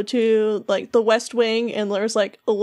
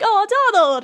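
delighted squeal of greeting, pitched way up; excited Belle; 10 semitones higher, 3 dB louder than usual. Retold or imitated. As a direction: greet a dear friend in a high, thrilled cry. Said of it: "just so excited to see Donald".

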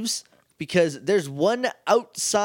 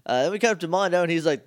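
normal talking voice

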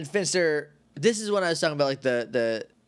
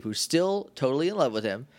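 ordinary talk, nothing marked

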